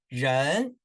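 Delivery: 人 drawn upward in a rising tone; second tone. Shown as a demonstration. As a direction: model 人 with the pitch climbing. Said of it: "second tone，right".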